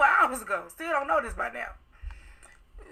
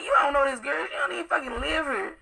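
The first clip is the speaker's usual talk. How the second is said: raised, high-pitched arguing; indignant retold retort; 5 semitones higher than usual. Retelling her comeback to the man, indignant and raised. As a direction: argue back high and incredulous.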